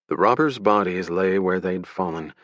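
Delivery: usual speaking voice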